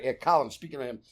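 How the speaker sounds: ordinary talk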